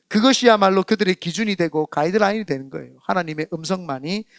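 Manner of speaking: ordinary delivery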